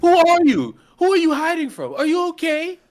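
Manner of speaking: high, loud alarmed yelling; loud, high-pitched shock; startled shout. Real, not acted. Startled by masked man on screen.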